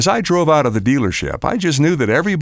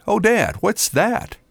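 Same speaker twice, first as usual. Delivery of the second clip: lightly voiced child, pitched up; son's question; 6 semitones higher, slower than usual. A narrator's reading. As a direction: ask it high, like a curious boy. Narrator voices son spotting the ding.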